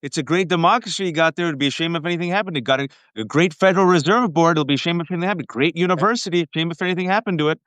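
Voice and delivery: sweet-talking mob shakedown; smiling menacing loan shark. Retold or imitated. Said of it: "Trump talks like a gangster".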